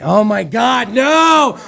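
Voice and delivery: loud cry rising to a shout; mock-horrified shout; appalled outburst. Real, not acted. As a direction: break out of it loudly. Snaps out of sexy voice, appalled.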